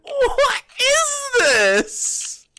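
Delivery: very high, drawn-out squeal of disbelief; real high-pitched incredulous outburst; baffled shock. Real, not acted. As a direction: squeal it in helpless bewilderment. Baffled by bizarre repeating clip.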